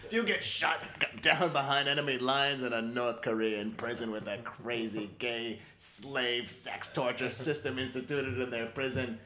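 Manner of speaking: ordinary delivery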